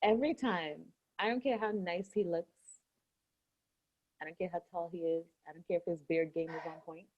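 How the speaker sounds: quiet, secretive; hushed confiding. Real, not acted. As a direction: lean in and keep it hushed. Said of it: "just between me and you".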